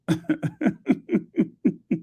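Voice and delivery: high-pitched run of giggles; laugh. Real, not acted. Amused that she named one of everything, high laugh. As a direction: laugh in short, high, separate pulses.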